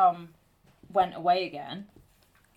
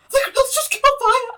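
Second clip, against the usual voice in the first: much higher and louder, frantic blurt; panicked cry; 15 dB louder than usual. Retold or imitated. Daughter phoning her mum after the grill fire, panicked. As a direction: gasp it out as if the kitchen is still burning.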